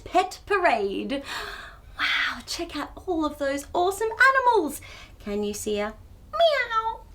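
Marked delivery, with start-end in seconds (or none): none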